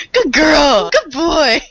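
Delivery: high sing-song praise for a dog; puppy praise voice. Pretend. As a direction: praise them like a good puppy.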